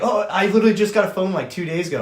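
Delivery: high, fast, loud imitation of her; hurried excuse. Girlfriend explaining her silent phone to Bob, hurried. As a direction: rush out a defensive excuse, easing down at the end.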